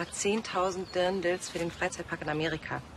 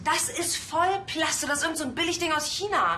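disgusted; 6 semitones higher than usual. Acted. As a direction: sneer at the cheap fabric. Lena scorns the cheap dress.

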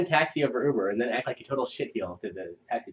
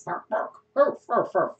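dog barks; 9 semitones higher than usual. Acted. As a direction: play the dog yipping at its owner. Voicing the dog Rebel.

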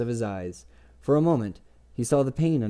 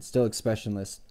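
ordinary delivery, nothing marked

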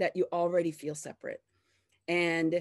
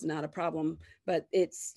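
ordinary delivery

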